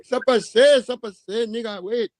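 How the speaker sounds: high, excited put-on Haitian accent; mock Haitian accent. Host imitating a Haitian guy waiting to strike, mock Haitian accent. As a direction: imitate a Haitian man plotting eagerly. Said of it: "The Haitian be like".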